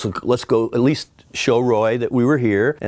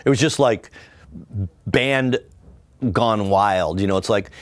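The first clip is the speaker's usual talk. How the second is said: normal talking voice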